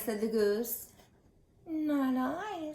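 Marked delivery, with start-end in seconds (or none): 1.7-2.7 high, lightly voiced cat refusing; a high little cat saying no. Cat turning down the hen, high and light.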